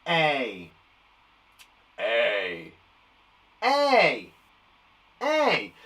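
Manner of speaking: "A" repeated as a drawn-out "ay", with pauses; "A" as a held "ay", one at a time; long A sound. Shown as a demonstration. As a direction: model each "A" as a long "ay" for copying. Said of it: "Can you say that?".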